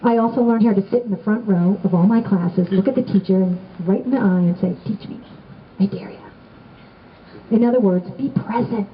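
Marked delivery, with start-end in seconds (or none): 4.8-6.2 hushed, whispery challenge; quiet dare. Acted. Student eyeing the teacher from the front row, hushed dare. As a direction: dare them almost under the breath.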